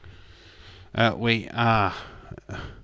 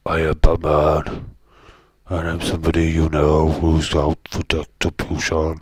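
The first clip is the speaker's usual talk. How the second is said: Batman voice; 3 semitones lower, 8 dB louder than usual. Retold or imitated. Streamer narrating the film in a hammed-up low Batman voice. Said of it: "and he talks like this".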